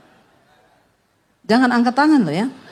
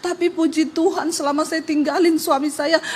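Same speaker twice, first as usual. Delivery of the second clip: usual speaking voice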